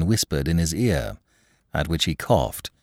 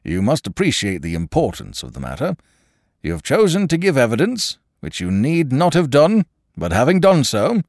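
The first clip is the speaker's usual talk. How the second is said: brisk; 4 semitones higher than usual. Acted. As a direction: lecture the witness sharply. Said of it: "said the coroner briskly".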